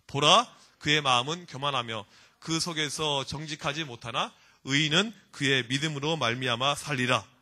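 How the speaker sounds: slow, low, measured recitation; scripture reading. Real, not acted. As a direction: lead the congregation through the verse. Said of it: "우리 같이 한번 읽어봅시다".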